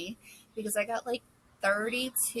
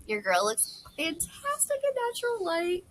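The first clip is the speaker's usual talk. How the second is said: playful brag; 11 semitones higher than usual. Pretend. Playful self-compliment on camera.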